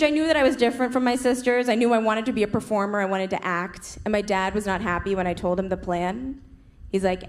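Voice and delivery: usual speaking voice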